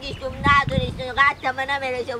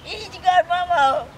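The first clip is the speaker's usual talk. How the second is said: high, drawn-out tearful wail; whining, stretched-out cry; 7 semitones higher, 3 dB louder, slower than usual. Acted. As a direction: answer through loud put-on sobbing.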